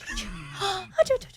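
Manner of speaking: high startled gasp; mock gasp. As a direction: gasp like you just heard the baby. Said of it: "I'm like".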